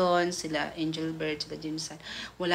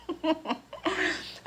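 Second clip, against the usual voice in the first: high giggle breaking into the story; a real giggle over the gossip; 10 semitones higher, 4 dB quieter than usual. Real, not acted. Vlogger giggling to her viewer about Eden, high giggle.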